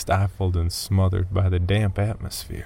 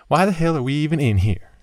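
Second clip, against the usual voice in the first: sharp, fast and higher, annoyed; irritated; 8 semitones higher, 4 dB louder, much faster than usual. A narrator's reading. Girlfriend in the cave asks why they're here, irritated. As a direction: snap the question in irritation. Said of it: "She sounded irritated".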